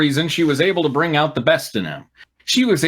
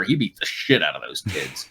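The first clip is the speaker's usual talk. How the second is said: mock gloating; 9 semitones lower, 5 dB quieter than usual. Dramatic read of brutal line.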